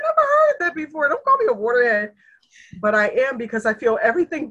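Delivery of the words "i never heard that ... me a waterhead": much higher, louder playful outrage; a hammed-up huffy retort to a teasing friend; mock indignant protest. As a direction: squeal it back in mock offense. Retells her indignant reply to friend.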